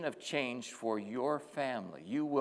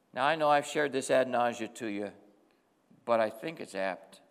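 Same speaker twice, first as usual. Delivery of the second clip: usual speaking voice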